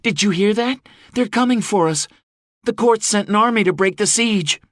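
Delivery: excited calling out. A narrator's reading. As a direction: call the good news to the crowd. Calling news to her people.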